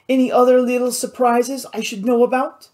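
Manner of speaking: high-pitched, exasperated question. Acted.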